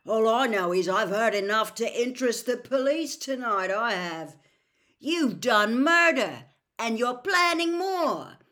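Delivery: loud, accusing voice, climbing in pitch; defiant character voice. A narrator's reading. Frank accusing the voice of murder, loud and defiant. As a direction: throw the accusation at him loudly. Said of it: "he added, on a sudden inspiration".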